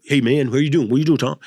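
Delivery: a hint of [name], quick and casual. [name] roommate greeting Tom, a light [name] voice. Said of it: "twenty-four hours of [name]".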